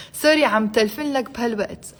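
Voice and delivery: quick, run-together Arabic sentence; a bit fast. Shown as a demonstration. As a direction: say it at natural fast speed. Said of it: "I said it a bit fast".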